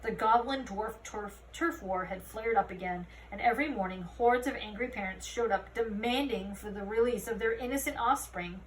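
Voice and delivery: ordinary delivery, nothing marked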